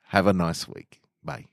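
ordinary delivery

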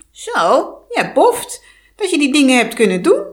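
loud sneering nasal witch; sarcastic, nasal. Acted. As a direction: sneer it through the nose. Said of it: "sprak sarcastisch door haar neus". (sarcastisch, door de neus)

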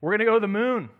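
put-on loud, high presidential proclamation in an Irish accent; John F. Kennedy's Irish accent. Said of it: "in his Irish accent".

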